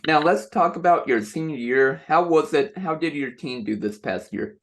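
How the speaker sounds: usual speaking voice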